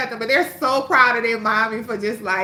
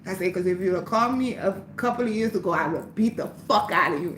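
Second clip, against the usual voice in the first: low, quiet threat to the man who called her out; quiet warning; 4 semitones lower, 4 dB quieter than usual. Retold or imitated. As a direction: let him know he got off lucky.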